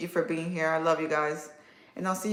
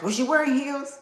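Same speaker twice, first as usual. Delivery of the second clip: much higher, lightly voiced mom asking; a hint of a nosy mother; mom's eager question; 9 semitones higher, 3 dB louder than usual. Creator imitating her late mom asking about heels, eagerly. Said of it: "The first thing she asked".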